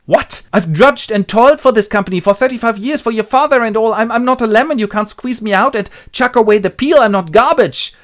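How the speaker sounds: high, loud indignant protest; acted outrage. Willie protests to his boss at being sacked, outraged.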